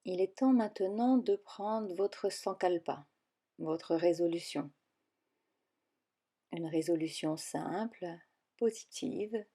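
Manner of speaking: calm guided relaxation voice, with long pauses between phrases; guided meditation. Acted. Yoga teacher guiding listeners into yoga nidra, calm meditation voice.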